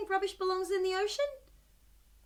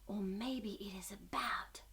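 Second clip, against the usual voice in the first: slow hushed whisper, much softer; 7 semitones lower, 10 dB quieter than usual. Acted. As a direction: lean in and whisper slowly. Guessing story, hushed aside.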